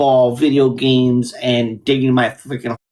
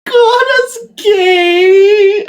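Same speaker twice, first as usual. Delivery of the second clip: much higher, slow, put-on whiny kid; mocking kid voice; 8 dB louder, much slower than usual. Podcast host mimics the kids' taunt in a whiny kid voice. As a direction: drag it out like a snotty kid.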